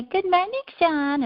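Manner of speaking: high, bright sing-song good morning; mock-cheerful neighbor calling out; neighbor's greeting. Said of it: "he'd say".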